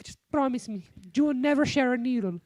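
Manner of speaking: high, pleading mom voice, mimicked; imitating his mom. Son mimics his worried mom, high pleading voice. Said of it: "She's like".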